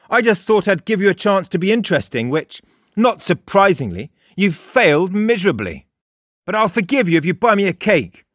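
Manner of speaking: put-on high, loud, cocky boy's voice; mocking Frost. A narrator's reading. Frost lectures Jake, high and loud, mocking. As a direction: needle him with a sneer.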